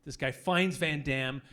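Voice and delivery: usual speaking voice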